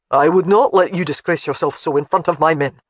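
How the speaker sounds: lightly voiced stern husband; a hint of a proud Scottish laird. A narrator's reading. Alec's voice in the story.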